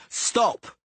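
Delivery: furious yelled stop. A narrator's reading. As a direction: bark the order to halt. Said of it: "Cicada yelled".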